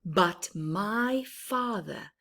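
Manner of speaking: lively storybook voice, rising on big moments; reading to children. Acted. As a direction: read it brightly to little ones.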